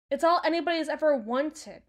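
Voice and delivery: sudden pained raise of the voice; bitter outburst. Acted. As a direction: burst out with it, fast and aggrieved. Bitter outburst about giving blood.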